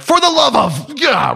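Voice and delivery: loud, high cry of mock agony; exasperated outburst. Student worn out by twenty minutes of drills, exasperated yell.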